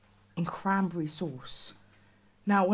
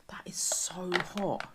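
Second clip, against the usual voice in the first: slow, breathy whisper at the heat; 4 dB quieter, slower than usual. Real, not acted. Burnt by hot food.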